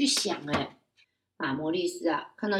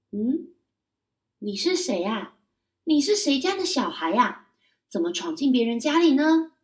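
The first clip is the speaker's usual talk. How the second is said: puzzled mother; 5 semitones higher, 6 dB louder than usual. Acted. Mother doesn't recognize son.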